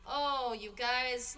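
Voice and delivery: normal talking voice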